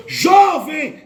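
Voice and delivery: loud, rising call of jovem. Retold or imitated. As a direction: call out urgently. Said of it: "Ela falou".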